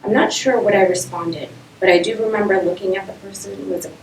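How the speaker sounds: projected narrative-poem recital; poem reading. Acted. Poet telling an audience of being an outsider, projected recital. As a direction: read it out firmly, line by line.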